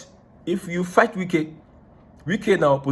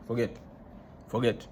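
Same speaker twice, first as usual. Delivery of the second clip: softer, lower, throwaway forget; dropped to a murmur; 6 semitones lower, 12 dB quieter than usual. Real, not acted. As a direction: let Forget fall almost away. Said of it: "repeated dismissal, trailing off".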